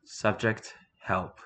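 plain reading voice

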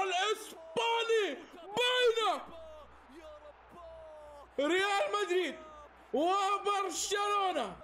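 high, slow, drawn-out announcer call; announcer build-up. Acted. Announcing the final to viewers, a slow booming call. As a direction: stretch the team names like a stadium announcer.

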